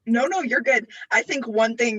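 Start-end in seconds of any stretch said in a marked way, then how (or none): none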